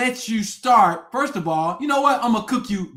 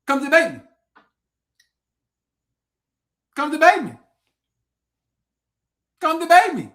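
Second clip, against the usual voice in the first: higher and louder each time, daring them; taunting challenge; 6 semitones higher, 5 dB louder than usual. Real, not acted. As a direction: call them out, building louder. Challenging viewers to debate.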